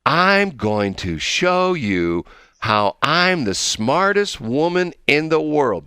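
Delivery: very slow, drawn-out mock of a politician; imitating Kamala Harris. Host mocks Harris bragging to listeners, slow and drawn out. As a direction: stretch each word like a patient speech. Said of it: "she talks like this".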